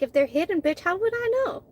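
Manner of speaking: high mocking pitch on how would I know; sassy disbelief, pitch soaring; a driver silently sassing the border agent, mock incredulous; sarcastic inner retort.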